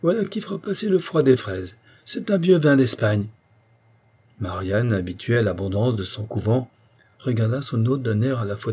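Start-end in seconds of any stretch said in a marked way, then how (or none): 0.0-3.3 high, proud announcement; elated, voice raised in pitch; triumphant exclamation. A narrator's reading. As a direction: show off the find in triumph. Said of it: "s'exclama-t-il".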